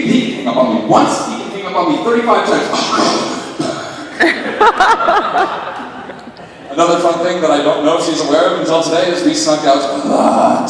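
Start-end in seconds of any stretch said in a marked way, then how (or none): 2.7-6.7 breathy high laughter; cracking up at the memory; airy giggling fit; long high giggle. Real, not acted. Laughs after phone story.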